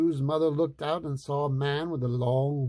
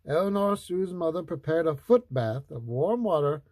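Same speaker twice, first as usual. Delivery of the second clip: ordinary delivery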